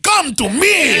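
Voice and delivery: high, hammed-up war cry; mock battle challenge. Defending his joke with a sword drawn, mock heroic challenge. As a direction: call out like a swordsman daring foes.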